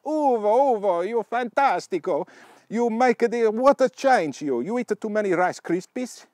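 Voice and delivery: loud, high, hammed-up Italian cheering; over-the-top Italian accent, loud and high-pitched; mock Italian accent. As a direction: congratulate a friend like an excitable stage Italian. Voicing Italian friends congratulating Ugo.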